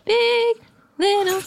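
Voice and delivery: high airy female backing vocal; woman's backing voice. Pretend. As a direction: chime in like a folk backing singer. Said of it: "a woman's voice that's like".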